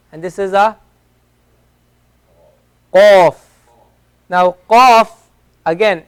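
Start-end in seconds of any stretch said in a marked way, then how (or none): 2.9-3.3 qaf punched from low in the throat, louder; Arabic qaf. Shown as a demonstration. Teacher demonstrates the deep throat letter qaf, loudly. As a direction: hit the q of qaf hard and deep. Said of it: "taken from the lower part of the throat".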